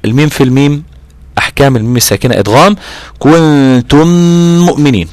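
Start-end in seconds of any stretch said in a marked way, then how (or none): 3.2-5.1 "كنتم مؤمنين" higher, with long nasal hums on n and m; lengthened ghunna. Shown as a demonstration. Teacher showing meem-into-meem merging, lengthened ghunna in "كنتم مؤمنين". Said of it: "بطول فيها وبجيب مقدار الغنة".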